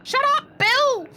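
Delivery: loud high yell at the character; panicked shout. Real, not acted. Terrified player yelling at Bill.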